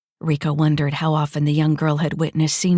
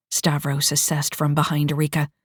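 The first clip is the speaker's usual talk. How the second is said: ordinary talk, nothing marked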